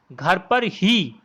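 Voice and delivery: slow, leaning hard on ही; stress on ही. Shown as a demonstration. Teacher demonstrating emphasis on ही to students. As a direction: stretch out घर पर ही and press the ही. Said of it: "यहाँ पर ही जो है एक प्रकार का बल दे रहा है".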